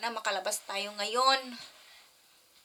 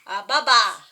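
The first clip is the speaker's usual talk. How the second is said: "baba" called out much louder; 12 dB louder than usual. Shown as a demonstration. Mother urging her toddler to say it louder, loud call. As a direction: boom out "baba" for the child to copy.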